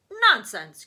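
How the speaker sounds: loud, scoffing dismissal; loud cry. Acted. Grandpa dismissing grandma's worry, loud cry. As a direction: brush it off with a hearty shout. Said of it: "cried Grandpa Joe".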